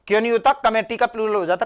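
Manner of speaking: ordinary talk, nothing marked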